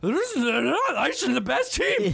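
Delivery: high, hammed-up Twitter fan; mock fan voice. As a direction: whine like an angry fan. S0 mimics fans, high voice.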